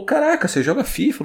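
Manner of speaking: high, excited imitation of a friend's surprise; amazed exclamation. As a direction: mimic the friend gasping in disbelief. Friend amazed on seeing FIFA.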